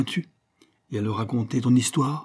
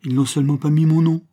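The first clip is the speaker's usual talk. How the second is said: louder, higher hurt indignation; naive wounded pride; 5 semitones higher, 8 dB louder than usual. Acted. Apache miffed his name is missing, naive wounded pride. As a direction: sound naively offended. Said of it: "du ton naïf de l'amour-propre froissé".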